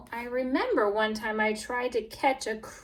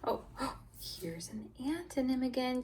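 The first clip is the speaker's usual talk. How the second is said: hushed gasp of discovery; excited whisper; 3 semitones higher, 6 dB quieter than usual. Shown as a demonstration. Teacher to class, gasping excited whisper. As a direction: gasp softly as if spotting something.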